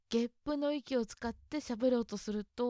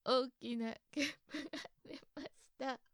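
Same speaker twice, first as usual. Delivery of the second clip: laughing; 5 semitones higher, 4 dB quieter than usual. Real, not acted. Laughing after a big burp.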